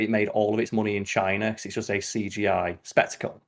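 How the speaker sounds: ordinary talk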